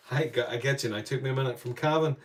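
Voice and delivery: ordinary delivery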